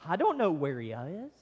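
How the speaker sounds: mock-innocent, pitch swooping up and down; sarcastic. Preacher voicing Cain dodging God's question, sarcastic. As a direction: feign ignorance with a sarcastic swoop. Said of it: "Do you sense the sarcasm".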